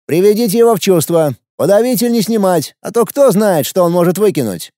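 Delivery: put-on teenage boy voice. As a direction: play an arrogant teenage ruler. Teen emperor gives orders. (голос подростка-императора)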